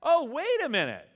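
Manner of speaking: hammed-up startled correction; mock surprise. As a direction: act as if you just caught the mistake. Catches his own misquote.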